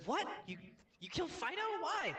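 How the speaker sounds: high, loud, incredulous outburst; shocked disbelief. Acted. Banker hears the man killed the dog, shocked.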